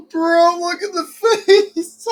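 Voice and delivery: words squeezed out through high, loud laughter; cracking up so hard the words barely come out; laughing. Real, not acted. Viewer laughing at the boyfriend's face, laughing through the words.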